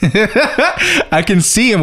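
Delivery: high, slow, through laughter; laughing. Real, not acted. Telling friends about the honked-at driver, laughing. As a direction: giggle while getting the words out.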